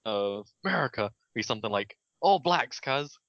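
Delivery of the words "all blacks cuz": put-on Kiwi accent. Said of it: "the New Zealand equivalent of".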